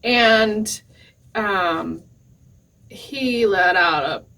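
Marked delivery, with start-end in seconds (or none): none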